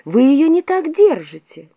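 high, urgent correction; urgent warning cry. A narrator's reading. Alice sees the Queen grab the brooch, urgent cry. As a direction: call out in mild alarm. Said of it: "закричала Алиса".

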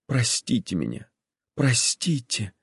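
soft, pleading whisper; quiet contrite whisper. A narrator's reading. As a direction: whisper the apology. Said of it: "прошептала Кити".

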